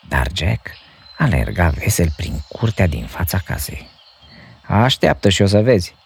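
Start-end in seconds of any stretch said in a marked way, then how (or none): 4.7-6.0 put-on cheeky boy. A narrator's reading. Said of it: "răspunse el".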